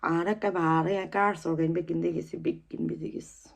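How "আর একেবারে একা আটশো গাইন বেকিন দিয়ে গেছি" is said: ordinary delivery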